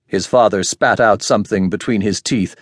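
usual speaking voice

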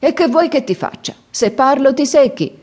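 high-pitched exasperated comeback; acted defensive retort; irritated retort. A narrator's reading. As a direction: throw the question back, pitch well up. Father retorts to exasperated son.

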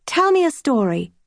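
put-on a little girl calling out at night. A narrator's reading. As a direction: call out to her sister across the room. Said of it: "Snow called in the dark".

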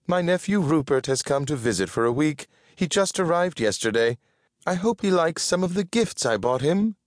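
high, chatty, pleased aunt; happy Winifred. Acted. Winifred talking of her visiting nephew, high and happy.

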